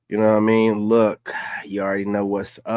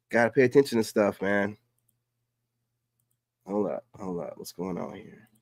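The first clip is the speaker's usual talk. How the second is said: ordinary delivery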